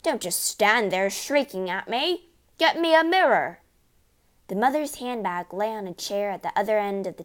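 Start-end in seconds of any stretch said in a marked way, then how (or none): 0.0-3.6 rising to a high, loud demand; furious yell. A narrator's reading. Father ordering shrieking wife about, yelling. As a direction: build to a bellowed order. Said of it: "the father yelled".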